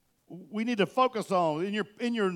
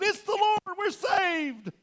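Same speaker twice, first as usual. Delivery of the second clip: excited cry; 14 semitones higher, much slower than usual. Acted. Disciples in the boat cry out in excited relief. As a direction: shout it like rescue has come.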